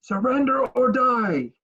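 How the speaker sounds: high, drawn-out threatening call; mock battle yell. Player standing up on Tiamat, high slow mock yell. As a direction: call it out slowly, high and commanding. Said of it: "and yell".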